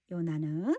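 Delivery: usual speaking voice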